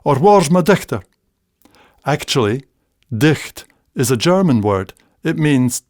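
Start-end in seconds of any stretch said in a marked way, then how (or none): 0.0-1.0 father's Scots. Retold or imitated. Imitating his father calling for his cleaning cloth, raised Scots voice. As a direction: call it out like the old man. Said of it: "I can hear him now saying".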